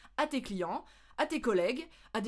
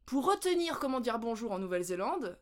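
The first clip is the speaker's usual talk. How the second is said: normal talking voice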